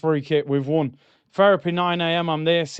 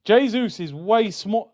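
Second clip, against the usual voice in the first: usual speaking voice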